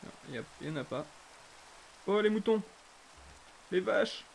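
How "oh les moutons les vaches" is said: excited shouts at a sighting; excited calling out. Real, not acted. Calls out on spotting animals.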